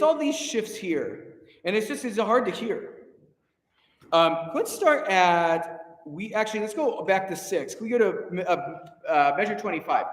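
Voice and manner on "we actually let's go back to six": ordinary talk, nothing marked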